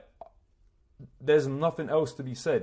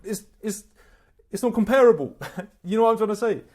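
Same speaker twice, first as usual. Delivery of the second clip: laughing; 9 semitones higher, 8 dB louder than usual. Real, not acted. Laughing after mock sleepy voice.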